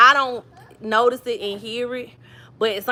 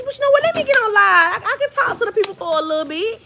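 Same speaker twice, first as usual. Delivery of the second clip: high-pitched, louder burst of sudden resolve; perky self-talk; 10 semitones higher, 3 dB louder, faster than usual. Retold or imitated. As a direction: pitch way up, acting out a bright idea. Acting out her sudden decision to go live.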